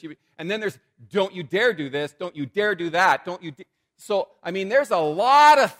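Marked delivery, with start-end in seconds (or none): 1.1-3.6 loud, wagging-finger don't you dare; hammed-up scolding prohibitions; mock scolding. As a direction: scold like a strict priest. Voicing priests' prohibitions.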